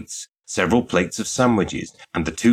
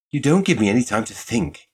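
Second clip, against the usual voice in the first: higher-pitched, mildly exasperated complaint; 6 semitones higher than usual. A narrator's reading. Shop girl to pressing suitor, exasperated. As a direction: push back at being rushed. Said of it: "but with considerable exasperation".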